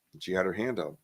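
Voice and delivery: ordinary talk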